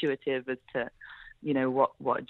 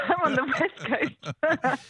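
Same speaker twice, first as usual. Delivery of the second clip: laughing; 9 semitones higher, much slower than usual. Real, not acted. Laughing about just waking up.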